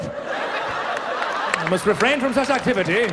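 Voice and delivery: slow, stiff pompous delivery; mock-formal vow after a silly injury. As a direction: say it like a solemn vow.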